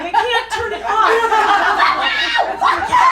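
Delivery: high, loud panicked shriek; high shout through laughter; retold screaming. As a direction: yell it like you can't stop. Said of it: "I was screaming".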